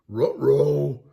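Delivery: loud dog-voiced uh-oh; worried cartoon dog; Scooby voice.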